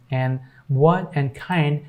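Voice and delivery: ordinary talk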